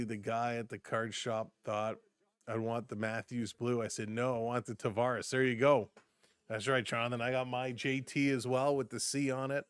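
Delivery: usual speaking voice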